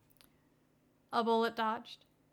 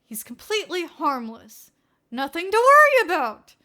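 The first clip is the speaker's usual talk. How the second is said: through clenched teeth; 5 semitones higher, 12 dB louder than usual. Acted. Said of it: "between clenched teeth".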